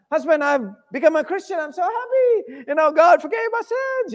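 bursting with happiness; excited. Acted. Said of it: "she's so excited".

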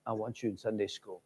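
hushed stern-but-kind preacher; low gentle scolding. Retold or imitated. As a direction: gently scold the hidden girl. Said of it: "And he said".

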